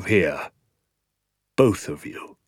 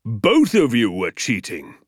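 slow, emphatic condemnation; stern accusation; slower than usual. A narrator's reading. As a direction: hit it slowly and firmly, accusing both pupils. Teacher accuses the boys.

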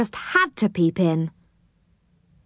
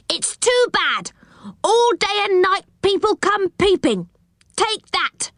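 high-pitched, fed-up outburst; angry pixie shouting; 12 semitones higher than usual. A narrator's reading. Pixie about to drench a peeping Rick, high angry rant. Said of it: "he shouted".

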